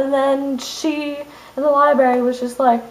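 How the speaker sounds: usual speaking voice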